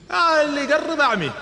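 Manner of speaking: high, loud threat with a laugh; a hammed-up fierce warning; mock threat. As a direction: warn them off while laughing. Omar threatens attackers.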